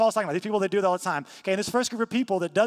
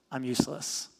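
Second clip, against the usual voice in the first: mopey Eeyore; 5 semitones lower, 4 dB quieter than usual. Retold or imitated. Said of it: "Eeyore, kinda".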